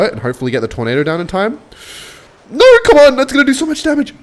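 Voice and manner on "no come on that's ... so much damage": high, loud cry of protest; alarmed shout. Real, not acted. Player seeing heavy damage coming, alarmed shout. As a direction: yell out in high-pitched alarm. Alarm during live game play.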